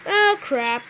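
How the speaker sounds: normal talking voice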